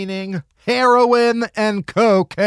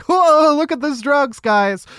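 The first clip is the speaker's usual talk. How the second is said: mock amazed; 7 semitones higher than usual. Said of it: "we're like".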